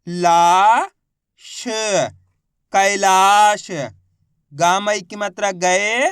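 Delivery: slow, loud, each syllable set apart and then blended. Shown as a demonstration. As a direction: spell it out like a teacher to children. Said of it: "मैं किस तरीके से एक एक अक्षर का उच्चारण करके इनको पढ़ रहा हूँ". (धीरे-धीरे, ज़ोर से, अक्षर-अक्षर अलग बोलकर)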